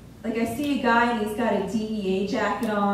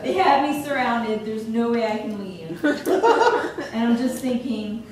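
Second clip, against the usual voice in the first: ordinary talk, nothing marked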